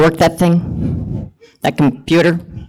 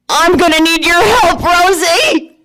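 calling out; 9 semitones higher than usual. Acted. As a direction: shout for Rosie to come help. Calling out for help.

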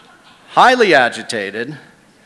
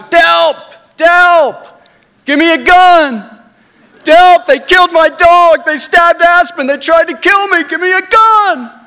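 much higher and louder, yelling for help; frantic screaming; 10 dB louder than usual. Retold or imitated. Drunk Jeff screaming to Delp for a gun, frantic yell. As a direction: scream it in panic and rage. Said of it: "Jeff screaming at the top of his lungs".

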